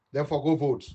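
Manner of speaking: ordinary talk, nothing marked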